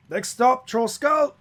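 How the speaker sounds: high, loud destination call; woman carriage driver. Acted. Cab driver to passengers, high loud announcement. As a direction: announce the stop at a holler.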